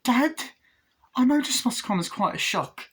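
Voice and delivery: put-on high, pleading child voice; Glenn's voice. Glenn calling to his doll parents, high and pleading. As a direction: call out to parents, hopeful and high. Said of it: "he's like".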